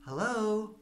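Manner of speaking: a hint of a curious old neighbour calling out; lightly voiced old lady. Neighbour calls over fence.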